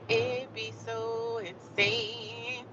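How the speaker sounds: very slow, soft, stretched words; hushed, long-drawn emphasis; drawn-out exasperation. Pretend. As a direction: drag out each word, especially "insane". Repeats it, stretched out.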